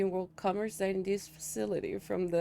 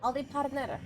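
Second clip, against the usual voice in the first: put-on cowboy accent; 4 semitones higher than usual. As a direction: greet like a lightly played American cowboy. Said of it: "to commit to the accent".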